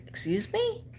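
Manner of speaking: retold indignant "Excuse me?" shot up high. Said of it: "I said".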